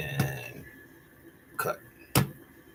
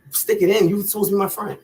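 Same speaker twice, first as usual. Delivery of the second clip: mocking whine; 8 semitones higher than usual. Mocking friend's taunt.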